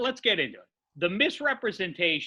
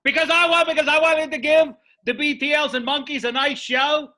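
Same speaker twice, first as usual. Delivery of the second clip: raised voice; 6 semitones higher, 5 dB louder than usual. Real, not acted. Heated argument with co-host.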